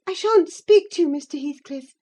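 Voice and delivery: put-on high girlish refusal; defiant young lady. Young lady refusing to speak to the rider, defiant.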